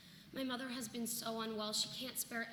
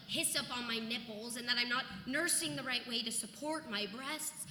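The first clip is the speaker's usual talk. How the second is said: ordinary delivery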